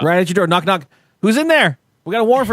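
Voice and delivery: lightly voiced cop calling through the door; mock cop at the door. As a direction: play a cop banging on the door, not fully committed. Mock police raid at the door.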